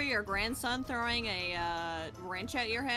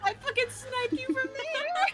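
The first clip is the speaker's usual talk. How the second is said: high gloating yell; triumphant shout; 11 semitones higher than usual. Real, not acted. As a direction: crow over the trick shot. Triumphant in-game kill.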